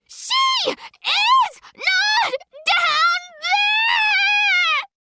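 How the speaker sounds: very high, loud, drawn-out piercing scream with crying; girl screaming hysterically at the grave; banshee scream. Acted. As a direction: wail it like a banshee. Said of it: "Molly's banshee screams ... high and sharp and piercing".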